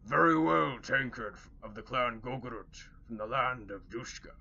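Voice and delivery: gruff orc leader, loud start; a big orc accepting the story. Acted. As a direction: orc speaking up for the others, granting passage.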